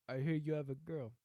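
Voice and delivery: lightly voiced mobster-like pastor; a hint of a mafia don. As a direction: open the confrontation as a mob-boss pastor. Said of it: "the pastor's office".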